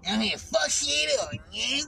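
Keyshawn impression. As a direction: yell it up high in his voice. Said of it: "that's how he talks".

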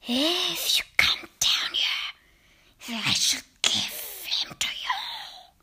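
slow whisper rising high at the end; rasping witch whisper. Acted. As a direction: dangle the snake as a bribe. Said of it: "Her voice had a curious rasping quality".

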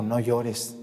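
soft, hushed consolation; tender comfort. Acted. Jesus comforting the weeping widow, softly tender. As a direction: gently soothe a crying widow. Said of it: "le dijo".

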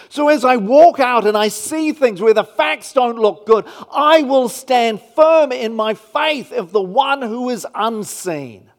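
loud, building sermon climax; rising preacher voice, declaring triumphantly; full-force preaching. Acted. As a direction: preach it out with conviction.

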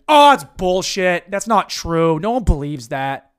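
loud, hammed-up scornful outburst; mock aggro guy. Guy chimes in against the DJ's judgment, loud mock outrage. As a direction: burst in loudly, then ease off. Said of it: "the guy just chimes in".

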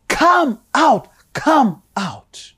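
preacher pleading with listeners, voice breaking; tearful plea. Real, not acted. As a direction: beg them, voice catching with emotion.

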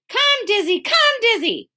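high, insistent dog call. A narrator's reading. The boy calls his old dog Dizzy, calling out. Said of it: "called the boy".

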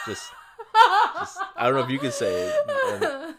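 laughing through the words; giggling while talking. Real, not acted. Laughing about the word fart.

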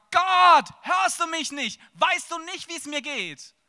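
high, loud, despairing cry upward; desperate yell to God. Retold or imitated. Alone in his car, crying out to God in loud despair. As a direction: shout your despair at the ceiling. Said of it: "wo ich in meinem Auto an die Decke schreie".

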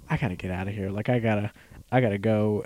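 urgent inner thought, kept down; quiet panic. Retold or imitated. Thinking he must escape the crowd, low and soft.

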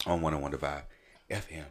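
hushed, breathy whisper into the mic; whispering on the phone. Real, not acted. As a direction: keep it down to a whisper. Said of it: "I'm whispering".

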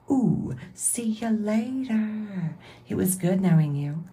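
high, slow, sing-song kiss-off; a drawn-out, snooty see-ya; mock dismissive goodbye. The judgmental friend waving someone off, slow and high.

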